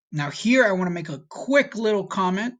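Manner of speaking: ordinary delivery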